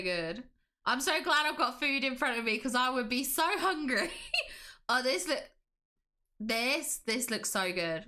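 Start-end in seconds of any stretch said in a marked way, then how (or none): 4.2-4.8 very high, brief laugh; light giggle. Real, not acted. Streamer looking at food photos for chat, giggling. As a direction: let out a tiny high-pitched giggle. Laughs after saying she'd be hungry.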